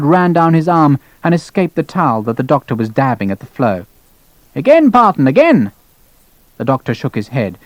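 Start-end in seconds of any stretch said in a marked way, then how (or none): 4.5-5.7 high, insistent order; demanding colonel. Acted. Colonel ordering doctor to bleed him, insistent.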